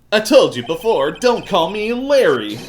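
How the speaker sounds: high, indignant character voice; Larry. Acted. Game character objects to his nickname, high and indignant. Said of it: "doing the Larry voice".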